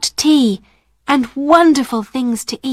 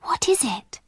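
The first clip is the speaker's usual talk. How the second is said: soft, breathy wondering whisper; 4 semitones higher, 7 dB quieter than usual. A narrator's reading. Sarah puzzled by a noise at night, whispered. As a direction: ask it under your breath. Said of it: "Sarah whispered".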